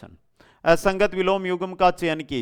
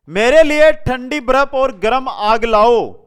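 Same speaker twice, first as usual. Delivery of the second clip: loud reading of a sentence; 8 semitones higher, 12 dB louder than usual. Shown as a demonstration. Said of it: "reads wrong sentence aloud".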